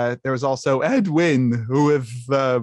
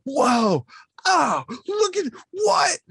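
high, slow awestruck gasp; amazed crowd; 13 semitones higher, much slower than usual. Retold or imitated. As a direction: gawk in amazement.

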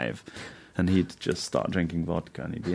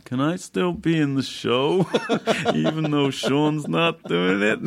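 pleading impression; 9 semitones higher, slower than usual. Rich on phone, voiced.

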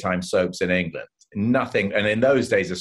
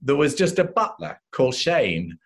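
usual speaking voice

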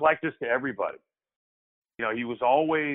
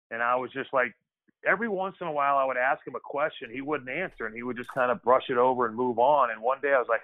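ordinary talk, nothing marked

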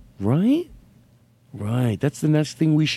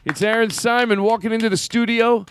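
announcer voice; 9 semitones higher than usual. Acted. Announcer-style show intro.

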